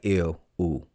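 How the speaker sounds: low, soft mock disgust. Host recalling first sight of Lady Dimples, mock disgusted. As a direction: recoil in lightly played disgust. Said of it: "I was like".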